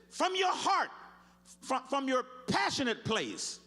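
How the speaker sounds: high, loud cry to God; preacher's fervent call to cry out; shouted plea. Retold or imitated. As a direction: yell it from the heart. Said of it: "the word means to yell".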